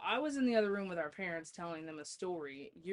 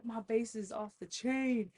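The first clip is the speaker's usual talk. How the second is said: proud brother; 5 semitones higher, 3 dB louder than usual. Retold or imitated. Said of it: "He's like".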